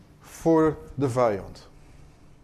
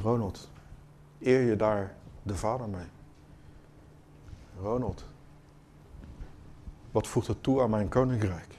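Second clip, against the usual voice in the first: much softer, gentle whisper; hushed, lower whisper; 6 semitones lower, 11 dB quieter than usual. Acted. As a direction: lean in and whisper the question. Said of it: "Hij fluistert".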